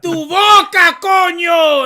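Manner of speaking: loud, high, slow Puerto Rican yell; Puerto Rican dude. Retold or imitated. Mocking Madara as a Puerto Rican tough, shouting high. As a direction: shout it like a guy in flip flops picking a fight. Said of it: "Puerto Rican dude".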